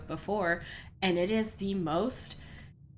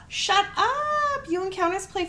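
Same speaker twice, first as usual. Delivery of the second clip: high, gasped shut up; delighted disbelief; gasping surprise; 12 semitones higher, 3 dB louder than usual. Real, not acted. Hearing viewers play Phasmo, gasping surprised delight.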